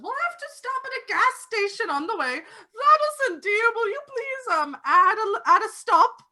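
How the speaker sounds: put-on high, loud, quavering old lady. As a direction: play a fluttery old woman asking a favour.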